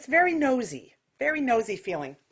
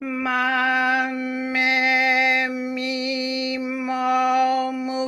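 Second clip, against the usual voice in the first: nasal quacky; much slower than usual. Shown as a demonstration. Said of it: "so nasal quacky".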